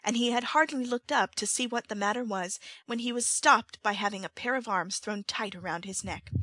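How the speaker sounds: ordinary delivery, nothing marked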